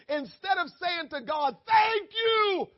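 preaching shout. Real, not acted. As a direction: belt it to the back row.

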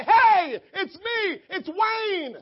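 high, loud hearty hail; shouted self-introduction; calling out. Acted. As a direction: burst out loudly to get someone's attention. Preacher acts out hailing someone.

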